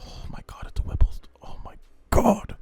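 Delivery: breathy unvoiced gasping whisper, rising at the end; awed whisper. Real, not acted. Host spotting a Whipple on the truck, awed whisper. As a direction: whisper it like a stunned fan. Spots supercharger, breaks off in awe.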